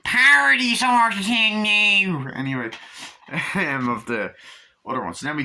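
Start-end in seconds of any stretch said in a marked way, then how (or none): 0.0-2.3 drawn-out high, loud outburst; exaggerated yell. Real, not acted. Telling listeners it's a piss take, exaggerated yell. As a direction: blare it out, stretching the words. Blurts out the word louder.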